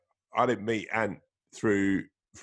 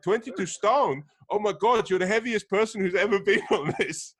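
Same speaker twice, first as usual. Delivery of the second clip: shocked instructor; 10 semitones higher, 6 dB louder than usual. Retold or imitated. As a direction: gasp it out like a stunned instructor. Instructor shocked by his weight.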